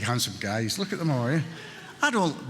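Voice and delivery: ordinary talk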